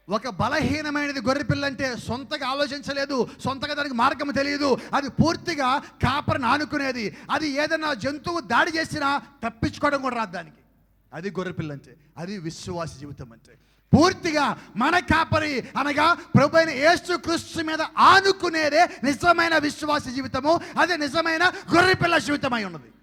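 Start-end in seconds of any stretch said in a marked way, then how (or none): 0.1-10.6 loud, driving sermon delivery; preaching. Acted. Preacher explains the helpless lamb, loud and driving. 13.9-22.9 loud, slow, high sermon climax; preaching at full force. Acted. Preacher proclaims true faith to the church, loud and slow.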